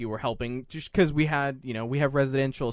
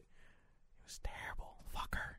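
bitter whisper under his breath; whispered aside; 21 dB quieter, slower than usual. Real, not acted. Recalling his ex's gossip, whispered and bitter. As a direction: drop to a whisper and curse her.

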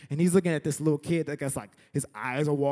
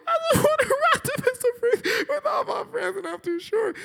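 very high, squealing fake cry; mock bawling; 3 dB louder than usual. Short kid to ride attendant, hammed-up bawling. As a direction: wail it like an overdone sobbing kid. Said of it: "And I'm just crying".

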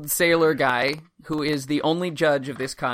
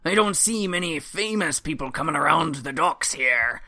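put-on old sailor voice; 4 semitones higher than usual.

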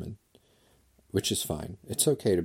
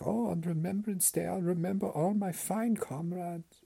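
high, hammed-up German accent, sentimental; mock German grandpa; 12 semitones higher than usual. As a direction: grandpa fondly remembering his comrades. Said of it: "be like".

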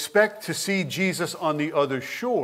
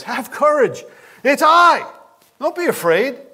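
high, bright jovial reassurance; jovial cheerfulness; 10 semitones higher, 6 dB louder than usual. Shown as a demonstration. Savior cheerfully rescuing rowers in a storm, jovial. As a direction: cheer them up as you rescue them. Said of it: "jovial cheerfulness of our good Savior".

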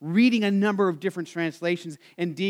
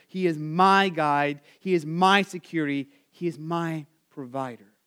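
gentle meditation; 4 semitones lower than usual. Acted. Preacher meditates on the shepherd images, low and gentle. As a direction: breathe each phrase out tenderly. Meditative recitation after pause.

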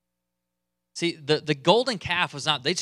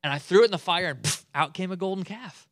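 put-on higher, childish innocent excuse; five-year-old [name]; 3 semitones higher than usual. [name] excusing himself like a five-year-old. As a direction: tell it like a caught child. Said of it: "sounds like [name]'s a five-year-old".

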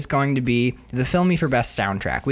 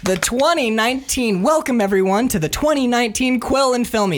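awards host; 7 semitones higher than usual. Acted. Host opening awards show.

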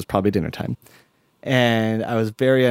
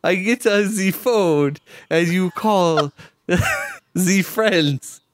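higher, slow broken English with a put-on foreign accent; hammed-up foreign traveller handing over the phone; mock foreign accent; 7 semitones higher, slower than usual. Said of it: "That doesn't sound fake whatsoever".

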